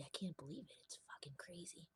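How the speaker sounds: hushed unvoiced whisper. Real, not acted. Confessing a secret to the camera, whispered. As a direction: breathe it out under your breath.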